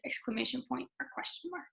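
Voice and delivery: ordinary talk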